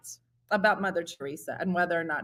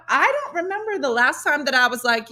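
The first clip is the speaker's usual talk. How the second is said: ordinary talk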